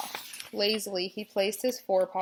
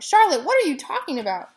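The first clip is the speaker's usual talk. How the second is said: high and loud, alarmed; alarmed cry; 8 semitones higher, 10 dB louder than usual. A narrator's reading. Pig begs spider to explain, alarmed cry. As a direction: demand an answer in alarm. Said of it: "he cried".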